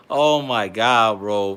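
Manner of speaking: drawn-out laughing oh my God. Real, not acted. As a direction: laugh through the words slowly. Laughing at his own joke.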